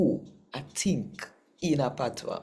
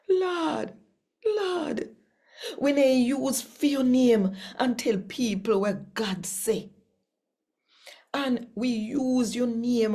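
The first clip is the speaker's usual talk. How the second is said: pleading crowd; 11 semitones higher than usual. Acted. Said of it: "a whole heap of people a go come and say".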